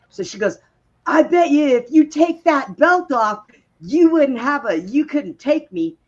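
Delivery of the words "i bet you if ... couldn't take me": higher, louder mocking challenge; taunting drunk girl. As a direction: dare her to fight, voice pitched up and brash.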